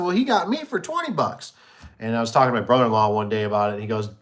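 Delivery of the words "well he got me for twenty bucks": high-pitched, dawning realisation; retold astonishment. Seller comparing losses with car salesman, retold astonishment. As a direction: pitch it up as you compare notes.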